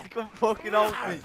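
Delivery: high, loud yell with a laugh; shouting for help, laughing. Real, not acted. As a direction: holler for help half laughing.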